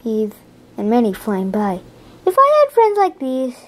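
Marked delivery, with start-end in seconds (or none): 2.2-3.6 high wistful voice of the spider; dreamy pleading, pitched up; wistful wish. Acted. Reading to a child, the lonely spider wishing. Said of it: "she sighed".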